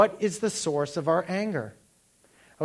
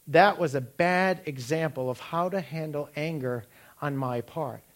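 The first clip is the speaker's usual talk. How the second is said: ordinary talk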